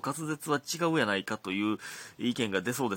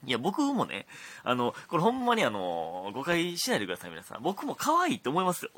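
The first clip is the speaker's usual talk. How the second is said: flustered, higher-pitched protest; desperate defending; 5 semitones higher, 4 dB louder than usual. Real, not acted. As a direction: plead your innocence, voice climbing. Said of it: "必死に弁解してるみたいになってますけど". (必死に弁解する口調)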